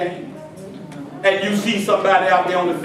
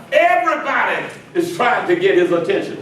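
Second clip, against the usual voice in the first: ordinary delivery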